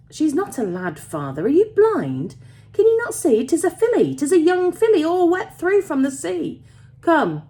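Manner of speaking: high, loud, incredulous dialect voice; astonished young miner. A narrator's reading. Son telling father it is a girl, loudly astonished. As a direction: exclaim at father's blunder, pitch leaping.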